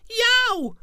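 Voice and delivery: sudden high yelp. Acted. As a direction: yelp out in pain. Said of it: "he yelped".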